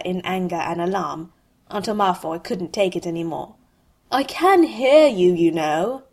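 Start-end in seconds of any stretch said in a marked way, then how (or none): 4.1-6.0 loud, high, slow call through a door; raised-voice announcement, dropping at the end; announced loudly. A narrator's reading. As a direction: call out pointedly, stretching it. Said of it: "he announced".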